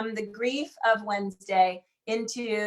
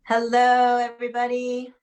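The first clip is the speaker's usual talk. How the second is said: loud, bright-voiced greeting to the room; host's big opening hello to the crowd; calling out; 7 dB louder than usual. Real, not acted. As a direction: call out the hello to everyone. Host greeting room at start.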